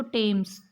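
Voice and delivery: टेम्स with a hard T, no th sound; said Tems. Shown as a demonstration. Teacher repeating the correct word for class, firm T. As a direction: say टेम्स with a clear T, falling. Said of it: "थेम्स नहीं पढ़ेंगे इसको".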